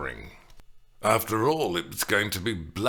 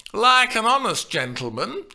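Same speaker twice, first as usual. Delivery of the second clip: teasing delay; 12 semitones higher, 7 dB louder than usual. A narrator's reading. Nurse teases Juliet by delaying the message. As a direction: dangle it before her. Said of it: "she paused again".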